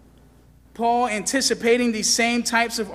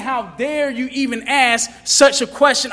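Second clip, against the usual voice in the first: raised, louder indignant challenge; preacher's acted outrage; indignant rebuke; 5 semitones higher, 6 dB louder than usual. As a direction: rebuke them as if affronted by the question. Preacher voices Paul's indignant rebuke.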